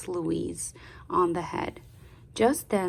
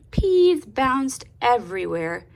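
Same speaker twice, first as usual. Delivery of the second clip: plain reading voice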